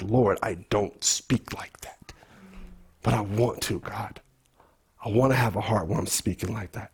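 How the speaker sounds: low, hushed confession to the Lord; humble prayer. Retold or imitated. Voices own confession to God.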